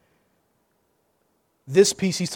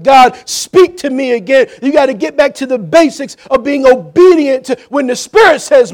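building, repetitive exhortation, raised voice; preach; 7 semitones higher, 11 dB louder than usual. Real, not acted. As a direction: urge the congregation with mounting force. Preaches at full force.

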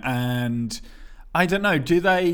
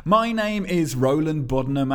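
ordinary talk

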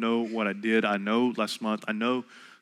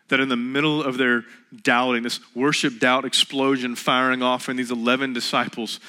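normal talking voice

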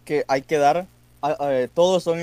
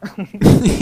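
loud burst of laughter; 6 semitones higher, 9 dB louder than usual. Real, not acted.

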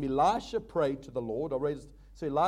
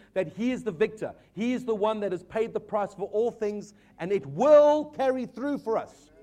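urgent, driving sermon delivery; rally the congregation with rising conviction; a preacher pressing hard on the stakes; preaching; 3 semitones higher than usual. Acted.

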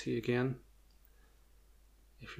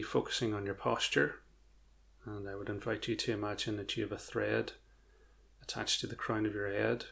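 guided meditation; 6 dB quieter than usual. Acted. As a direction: ease them gently into stillness.

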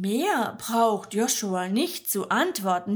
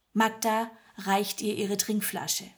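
usual speaking voice

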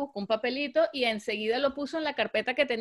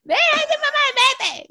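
put-on much higher, louder child telling mom to go; little girl voice; 8 dB louder, slower than usual. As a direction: push mom away like a bossy kid. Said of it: "y Savannah". (voz aguda de niña que echa a su mamá)

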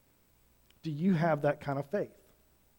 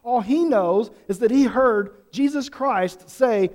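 usual speaking voice

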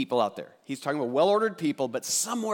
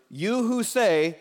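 slow, higher "You who say"; slowly single out "You who say"; calling forth; 7 semitones higher, 4 dB louder, much slower than usual. Shown as a demonstration. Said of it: "now he's gonna direct those words".

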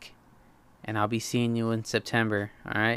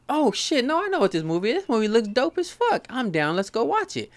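much higher, hyped-up retelling of his own excitement; excited realization; 11 semitones higher than usual. Retold or imitated. Telling his cousin he's down to see the film, excited and high. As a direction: squeal it out like the news just hit.

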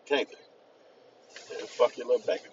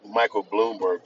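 usual speaking voice

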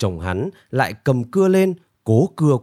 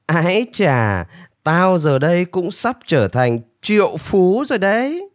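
pleased with himself, pitched up; gleeful gloating; 6 semitones higher than usual. A narrator's reading. Nanpu gloats over the magic seed.

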